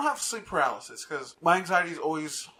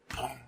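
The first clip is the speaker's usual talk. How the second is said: low, soft imitated boom; imitated deep boom; 5 semitones lower, 9 dB quieter than usual. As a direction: make a distant, muffled boom with your voice.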